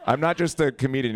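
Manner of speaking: normal talking voice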